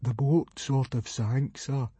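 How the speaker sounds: slow, small, timid voice; miserable confession. A narrator's reading. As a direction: confess it reluctantly and meekly. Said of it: "said Fishlegs miserably".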